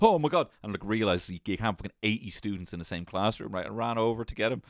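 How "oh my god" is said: shocked gasp. Retold or imitated. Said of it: "was like".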